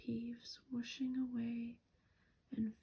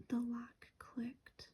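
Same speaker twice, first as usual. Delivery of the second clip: ordinary delivery, nothing marked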